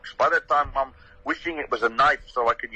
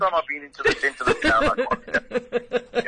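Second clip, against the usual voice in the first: laughing retort; 6 semitones higher than usual. Retold or imitated. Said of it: "I said".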